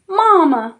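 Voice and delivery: high duckling cry. A narrator's reading. Ducklings snuggling up to the rabbit, high cry. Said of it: "the ducklings cried".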